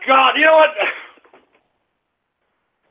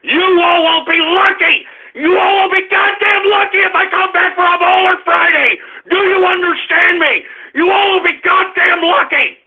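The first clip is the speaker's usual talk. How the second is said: furious warning; 3 semitones higher than usual. Real, not acted. Host threatens listeners he may skip Friday's show, shouting angrily. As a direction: holler it as a serious ultimatum. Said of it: "I'm serious as a goddamn heart attack".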